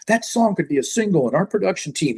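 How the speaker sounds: lightly mocked record-company exec; corporate talk. A and R people talking business backstage, mock corporate. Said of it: "they'd talk all, like, corporate".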